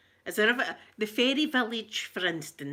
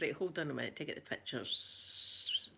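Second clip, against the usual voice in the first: ordinary talk, nothing marked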